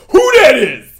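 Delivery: high, loud yelled interruption; mock yell. Imitating the kid cutting off the counselor, loud high yell. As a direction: burst in yelling it. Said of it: "he just yells out".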